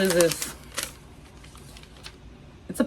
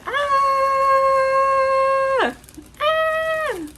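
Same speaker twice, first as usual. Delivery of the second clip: very high, short shrieks of excitement; excited squeal. Real, not acted. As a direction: squeal with delight at the reveal. Opening the box excitedly.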